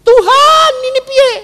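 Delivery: high, loud imitated cry to God; Musa. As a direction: yell upward in mock desperation. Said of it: "Musanya teriak-teriak sama Tuhan". (menirukan teriakan bingung, nada tinggi)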